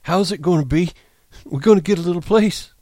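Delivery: put-on high, childlike Lenny voice; eager simple giant; Lenny's voice. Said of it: "said Lenny".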